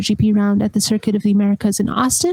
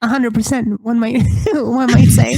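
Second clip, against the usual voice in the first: wry line broken by a laugh; giggling aside; laughing through it; 2 semitones higher than usual. Real, not acted. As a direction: chuckle while finishing the joke. Wry joke about hundred percent.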